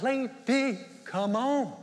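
mock exasperated father. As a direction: hurry her up, fed up. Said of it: "He's probably like".

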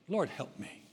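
softly imitated humble plea; quiet, understated appeal for help. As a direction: plead gently with a humble heart. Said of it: "Just an humble heart".

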